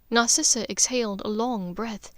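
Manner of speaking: ordinary delivery, nothing marked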